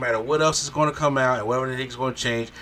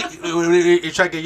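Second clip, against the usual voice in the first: normal talking voice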